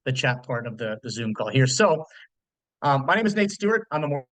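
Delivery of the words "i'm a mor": ordinary talk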